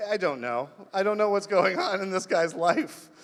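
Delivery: ordinary talk, nothing marked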